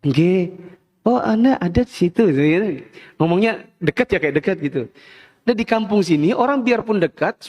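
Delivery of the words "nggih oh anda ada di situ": put-on Solo accent. Polite Solo person answers someone nearby, Solo accent. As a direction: lightly imitate close-up Solo talk. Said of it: "orang Solo misalnya".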